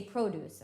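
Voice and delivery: produce as the noun, stress on PRO, long o: PROH-dooce. Shown as a demonstration. Teacher giving students the noun form, PROH-dooce. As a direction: say produce with a strong first syllable: PROH-dooce. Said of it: "So you say it differently".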